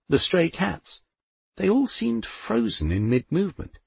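ordinary delivery

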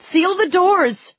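high, drawn-out urgent yell; crying out. A narrator's reading. Narrator as fighter ordering the doors sealed, crying out. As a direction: shout the command, stretching the words. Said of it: "I cried".

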